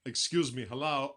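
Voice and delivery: lightly voiced, odd halting cadence; a hint of a quirky deadpan actor; mild eccentric pauses; Christopher Walken impression. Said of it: "Every time I do his voice".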